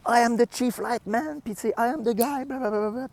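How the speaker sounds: high, sneering English impression; mock his self-importance in a high voice; mocking the chief. Mocking the chief electrician's boasting, high mock voice.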